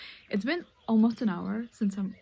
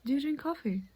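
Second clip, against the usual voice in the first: mock polite asker; 5 semitones higher than usual. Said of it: "Re-, read over, do over".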